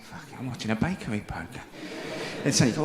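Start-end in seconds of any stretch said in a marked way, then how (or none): 0.0-1.7 much softer, low self-aware aside; muttered inner thought. Retold or imitated. Man to himself while watching Bake Off, hushed low mutter. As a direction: murmur it like catching yourself.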